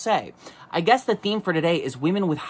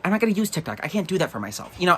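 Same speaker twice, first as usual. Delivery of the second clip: usual speaking voice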